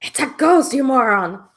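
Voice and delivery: exasperated yell. Acted. Player scolds in-character exasperated.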